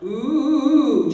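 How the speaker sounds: loud ooh in chest voice; heavy chest ooh demo. Shown as a demonstration. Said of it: "Chest voice".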